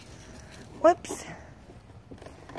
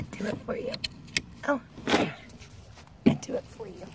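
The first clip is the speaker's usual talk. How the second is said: soft whisper; 6 semitones higher, 9 dB quieter than usual. Real, not acted. Helping toddler, soft.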